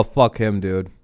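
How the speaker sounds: ordinary talk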